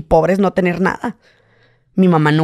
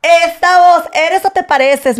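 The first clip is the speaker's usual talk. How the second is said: high, loud squealing fan; excited fan; 11 semitones higher, 5 dB louder, slower than usual. Retold or imitated. Fans at the plaza hearing his voice, high loud squeal. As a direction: shriek it like a fan who just recognized him. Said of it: "Me empiezan a decir".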